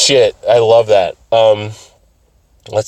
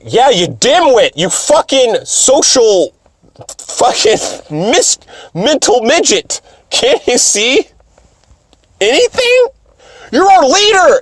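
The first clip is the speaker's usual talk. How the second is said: high, exasperated insult tirade; imitating high-pitched, incredulous annoyance; mock berating; 13 semitones higher than usual. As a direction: scold a hopeless leader, hammed up. Acted berating of the leader.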